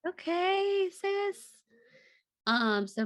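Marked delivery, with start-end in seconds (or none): 0.1-1.5 Okay sis sung out much higher; playful sis. Real, not acted. Answering her cohost teasingly in a much higher voice. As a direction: tease her friend in a high bright voice.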